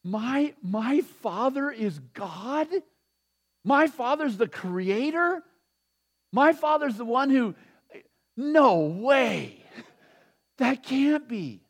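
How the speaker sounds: stunned wonder, building to "no way"; awed disbelief. Pretend. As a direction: say it as if you can't believe it. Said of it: "awed at God as Father".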